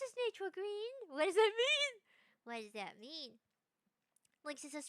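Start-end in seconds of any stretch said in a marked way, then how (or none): 1.1-1.9 high-pitched baffled demand; startled question. Real, not acted. Reacting to a chat message, startled question. 2.4-3.4 low, soft questioning; quiet repeat. Real, not acted. Asking chat about the message, quiet repeat.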